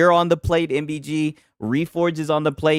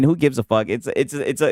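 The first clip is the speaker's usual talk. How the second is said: normal talking voice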